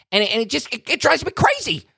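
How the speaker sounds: exasperated, rising rant; worked-up exasperation. Acted. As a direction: voice climbing high in frustration. Ranting about what fires him up.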